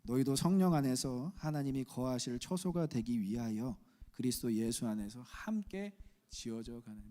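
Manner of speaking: slow, solemn reading aloud; scripture reading. Acted. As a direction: read the verse together with the church. (회중과 함께 성경을 천천히 봉독)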